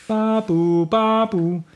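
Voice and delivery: high sing-song babu siren; ambulance siren. Acted. The storyteller makes the ambulance siren, sing-song. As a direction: imitate the ambulance coming.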